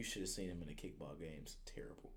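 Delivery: whispered verdict. Real, not acted. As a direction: breathe it out, no voice. Judging kid's kickball skills.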